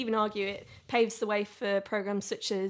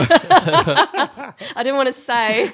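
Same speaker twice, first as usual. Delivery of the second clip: slow, loud laughing words; giggling through the line; 3 semitones higher, 13 dB louder, much slower than usual. Real, not acted. Laughing at clothes joke.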